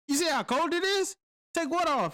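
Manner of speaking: high, disbelieving "take what off?"; protesting to the first sergeant in disbelief; incredulous protest. Retold or imitated.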